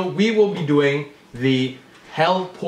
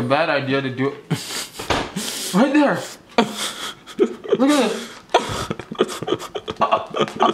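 lips stiff under mask; through peeling face mask; slower than usual. Real, not acted. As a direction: fingers tugging at mouth. Seen 2: Gray mask covers face; fingers peel it at mouth corner throughout.

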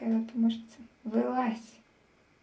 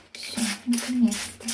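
ordinary talk

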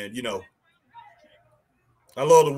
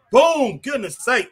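high, loud burst at the hit; excited shout; 8 semitones higher, 4 dB louder, slower than usual. Real, not acted. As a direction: yell it as the blow lands. Reacting to a big hit.

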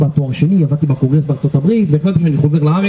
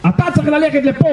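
high-pitched imitation of a commander; mock officer's order; 9 semitones higher than usual. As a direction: give the order in an exaggerated, raised voice. Officer ordering an arguing soldier.

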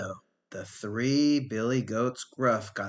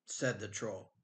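plain reading voice

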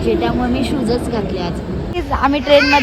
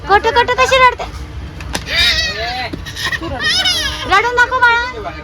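सतत उंच, लाडिक बाळबोली; बाळाला खेळवत गोड आवाजात बोला; बाळाशी लाडात; 7 semitones higher than usual. Real, not acted. आई बाळाशी लाडाने खेळते. (बाळाला हळू खेळायला सांगणारी लाडिक आई)

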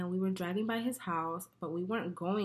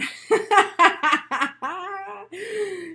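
loud laugh; 11 semitones higher, 10 dB louder than usual. Real, not acted. Laughs at the man running over.

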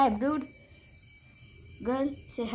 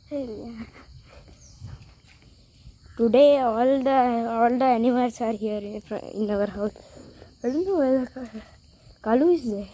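ordinary talk

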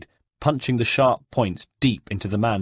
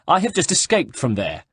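angry; 7 semitones higher than usual. Acted. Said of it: "now contorted in anger".

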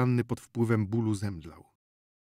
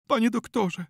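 high, anguished wail of appeal; plaintive cry, pitched very high; moaned plea; 12 semitones higher, 4 dB louder than usual. A narrator's reading. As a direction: moan it as a distraught mother. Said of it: "jęknęła pani Czyńska".

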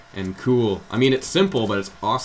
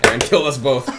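ordinary talk

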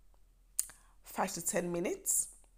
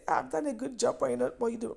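self-applause; 7 semitones higher, faster than usual. Acted. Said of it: "applaud yourself and say".

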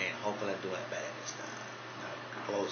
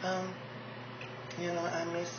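lightly higher, soft pageant-queen voice; Mercedes impression; 8 semitones higher, 5 dB quieter than usual. Pageant queen Mercedes thanking fans, lightly higher and soft. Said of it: "we got Mercedes Ellis Del Rio".

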